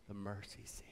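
very soft and low; quiet, awed answer; hushed reverence. Real, not acted. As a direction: let it drop almost to nothing. Reverent answer to own question.

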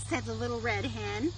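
gentle storybook reading voice; reading a story to children. Acted. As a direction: read it like bedtime story time.

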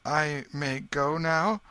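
put-on boy asking the king to go; timid question. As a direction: ask shyly for leave. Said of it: "turned timidly toward the king".

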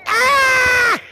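high, sustained mock scream; Lil Dude. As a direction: scream it out as an impersonation on the count. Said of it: "do your best Lil Dude impersonation".

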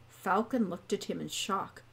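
plain reading voice

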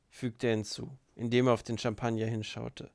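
ordinary delivery, nothing marked

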